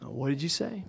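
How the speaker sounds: mildly nervous laughing, imitating Peter; thrown, uneasy half-laugh. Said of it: "Peter sort of nervously laughing".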